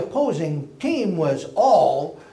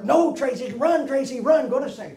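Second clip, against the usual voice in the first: calling out; 11 semitones higher, 4 dB louder than usual. Retold or imitated. Players urge Tracy onward.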